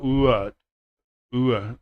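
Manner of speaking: "Ooh, uh" split into two sounds; mock learner w. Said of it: "they go".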